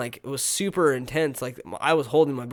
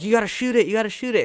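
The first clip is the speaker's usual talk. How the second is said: high, raised, excited egging-on; urgent urging; 9 semitones higher, 5 dB louder, faster than usual. Retold or imitated. Viewer urges the boy with the slingshot, urgent. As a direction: urge it like a worked-up viewer.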